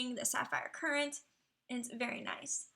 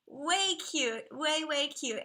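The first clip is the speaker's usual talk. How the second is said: normal talking voice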